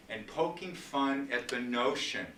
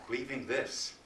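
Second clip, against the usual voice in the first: ordinary talk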